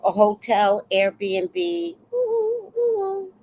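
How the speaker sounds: high mock-impressed gushing; acted gushing, starting high; humoring a bragger. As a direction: play along with put-on admiration. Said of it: "don't wanna stop her from bragging".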